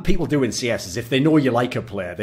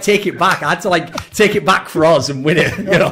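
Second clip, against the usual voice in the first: ordinary talk, nothing marked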